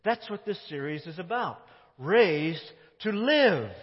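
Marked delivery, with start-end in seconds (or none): 2.0-3.7 Raised to live, slow and weighty, leaning on live; stress on living. Shown as a demonstration. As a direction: slowly press the word live home. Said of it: "The emphasis is on living".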